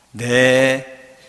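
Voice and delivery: drawn-out, level "네", loud; accepting Mary. Acted. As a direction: give a long yes of consent. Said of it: "이 세 마디로 끝납니다". (길게 수긍하는 "네")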